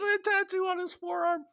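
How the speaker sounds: very high, squeaky mocking official; mock investigator. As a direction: send up the suspicious officer.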